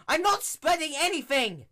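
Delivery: very high, loud shriek of outrage; indignant shriek. Acted. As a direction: shriek the denial. Twilight indignant outburst.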